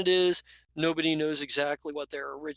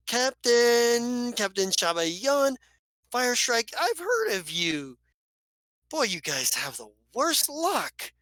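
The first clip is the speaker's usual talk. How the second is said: drawn-out, high showman's greeting; effusive rogue; 6 semitones higher, slower than usual. Acted. Ducey hailing a famous captain, effusive drawn-out voice. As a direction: stretch the names out like a smooth hustler.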